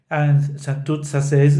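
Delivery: usual speaking voice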